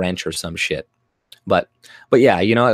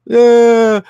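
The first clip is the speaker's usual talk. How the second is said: loud high mock toddler wail; mock tantrum wail; 12 semitones higher, 10 dB louder than usual. Streamer imitating a toddler's tantrum, loud high wail. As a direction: wail like a kid on the floor. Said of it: "he's crying and he's like".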